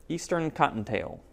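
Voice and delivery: usual speaking voice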